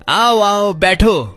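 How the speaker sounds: high, loud cheerful greeting; mother welcoming her son's friend excitedly; eager welcome. Acted. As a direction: hurry the guests in with delight. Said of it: "मुस्कुराते हुए बोली".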